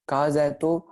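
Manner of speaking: ordinary talk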